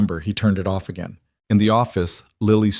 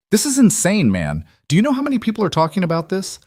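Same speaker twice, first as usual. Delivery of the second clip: higher, faster, amazed brother; excited disbelief; 11 semitones higher than usual. Acted. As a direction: pitch it up and rush it, can't believe it. Brother stunned by viral news.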